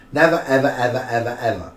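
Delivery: Never punched loud, the evers trailing down; emphatic never ever. Real, not acted. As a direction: hammer the never then fade the evers. Emphatic repetition.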